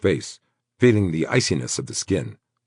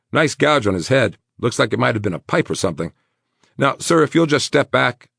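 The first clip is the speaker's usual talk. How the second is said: high, loud, brisk medic; brisk paramedic; 8 semitones higher, 5 dB louder than usual. A narrator's reading. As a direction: rattle it off like a tired medic. Paramedic voiced in story.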